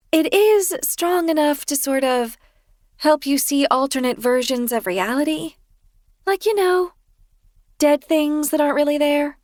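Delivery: high, sheepish confession voice; Kirsten sheepish. A narrator's reading. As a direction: voice her high and apologetic. Kirsten explaining.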